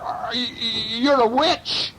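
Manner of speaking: high, stammered accusation; stutter, then blurt it in mock horror; terrified stammer. A narrator's reading. Burglar accuses aunt after biting threat, stammering horror.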